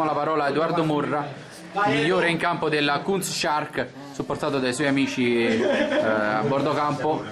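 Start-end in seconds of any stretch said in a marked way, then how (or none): none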